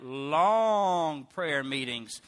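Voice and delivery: "long" held slowly for comic weight; drawn-out LONG. Acted. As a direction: stretch the word "long" way out. Showing how long they were.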